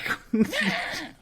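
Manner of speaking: high-pitched imitated goat cry; mock goat scream; crying goat bleat. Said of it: "sounds like a fucking crying goat".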